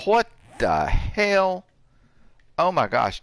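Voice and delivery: drawn-out, high, loud disbelief; what the hell. Real, not acted. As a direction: stretch it out in outraged shock. Shocked to find weapons unloaded.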